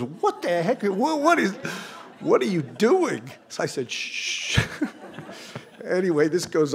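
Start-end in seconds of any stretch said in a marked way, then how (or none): 0.0-3.3 high-pitched mock outrage; hammed-up falsetto disbelief, drawn out at the end; imitated alarmed wife. 3.9-4.6 drawn-out mock shush; acted shush breaking into a laugh; long shushing. Hushes his wife in the story.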